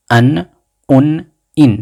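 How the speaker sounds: bright drill of an, un, in; nunation drill. Shown as a demonstration. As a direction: make the final n sing out. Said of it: "C'est pour ça qu'on dit tanwin".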